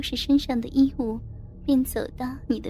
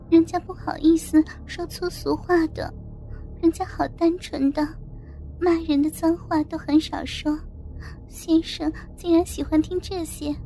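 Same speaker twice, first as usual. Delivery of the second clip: higher, halting, bashful flirting; coy flight attendant; 3 semitones higher than usual. Acted. Junior attendant to her passenger, coyly embarrassed. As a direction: feign shyness, teasing and coquettish.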